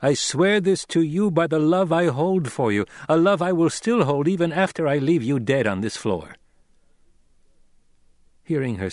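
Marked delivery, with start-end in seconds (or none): 0.0-6.4 high, loud vow; solemn, fierce oath. Acted. Young lord threatening the friend he loves, fiercely solemn. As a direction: swear it with intensity. Narrator voices Paul swearing.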